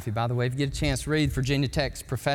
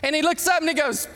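usual speaking voice

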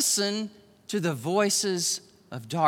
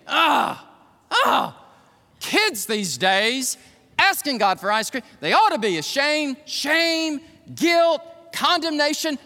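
higher and louder, scolding and scornful; unkind critic; 7 semitones higher, 4 dB louder than usual. Shown as a demonstration. Preacher imitating the critic's voice, loud scolding scorn. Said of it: "the voice of negativity, the unkind critic".

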